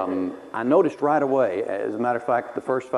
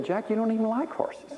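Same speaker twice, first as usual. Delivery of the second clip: high-pitched imitation of mom; puzzled mother; 10 semitones higher, 4 dB quieter than usual. Mother reasons with jealous son, high imitated voice. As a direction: raise the voice into a mother's protest.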